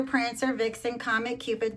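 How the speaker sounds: usual speaking voice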